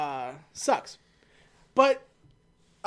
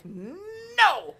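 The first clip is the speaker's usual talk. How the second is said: a prim, outraged no; mock indignant no; 11 semitones higher than usual. H refuses to hand over the gun, high and indignant. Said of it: "And he's like".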